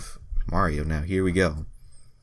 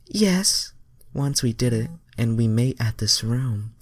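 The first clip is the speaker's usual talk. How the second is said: high, innocent alien voice; Starfire voice; 6 semitones higher than usual. Acted. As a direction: pitch up, sweet and guileless. Starfire answers Sonic in fanfic.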